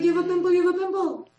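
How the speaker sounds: high, dismissive singsong; mock shrug. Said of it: "I'm like".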